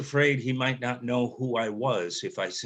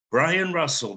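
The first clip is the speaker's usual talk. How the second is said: excited; 6 semitones higher, 4 dB louder than usual. Retold or imitated. Father's voice on the phone.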